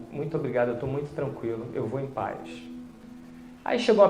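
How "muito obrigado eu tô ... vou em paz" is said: softer, at peace; peaceful farewell. Retold or imitated. Dying man thanking his visitor, softer peaceful farewell. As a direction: let it settle, softer, calm gratitude.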